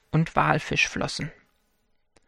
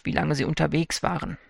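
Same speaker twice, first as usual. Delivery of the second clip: ordinary delivery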